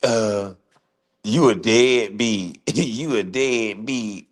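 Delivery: slow, drawn-out mimic of a whiny accuser; mock him slowly, dragging each word; mocking imitation. Mimicking another man calling him a deadbeat, lightly drawn-out mock whine. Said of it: "when another man talking about".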